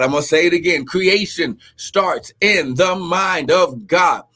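rising, earnest preacher cadence; building preaching. Real, not acted. Preacher teaching the congregation, rising intensity. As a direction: drive the scripture home to the church.

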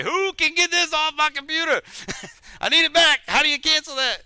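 high, loud mock panic over a sent email. Panicked quote after sending email.

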